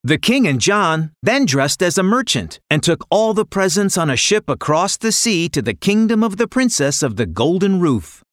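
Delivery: usual speaking voice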